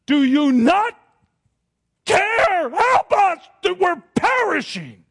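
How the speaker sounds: high, loud cry for help; desperate yell. Acted. Disciples yelling at Jesus in the storm, desperate. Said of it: "they yell out these words".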